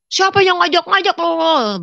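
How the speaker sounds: loud, high, drawn-out angry shout; angry retort. Retold or imitated. Husband fighting back at his mother-in-law, angry shout. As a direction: act out a defiant quarrelling shout. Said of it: "Dia lawan ibu mertuanya. ... Berantem lah".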